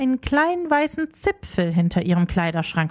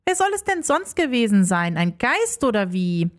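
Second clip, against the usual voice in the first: plain reading voice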